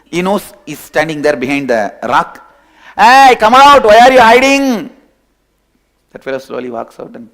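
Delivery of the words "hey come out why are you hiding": loud call to someone hiding. Acted. Lion calling to the hiding fellow, loud call. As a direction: shout him out from behind the rock.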